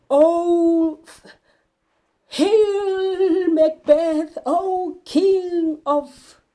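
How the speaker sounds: slow, high, eerie witch voice; high drawn-out witch hail; witches' greeting. Acted. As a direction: draw out the hail like a chanting witch.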